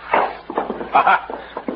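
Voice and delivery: breathy laugh. Acted. Cisco laughs after the slap.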